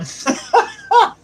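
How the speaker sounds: bursting into a high giggle; high laugh. Real, not acted. Laughs at reading's joke.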